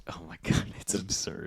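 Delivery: whispered in disbelief. Real, not acted. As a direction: breathe it out, no voice.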